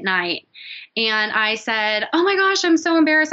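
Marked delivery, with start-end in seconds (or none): 2.1-3.3 flustered. Retold or imitated. Flustered apology on late call.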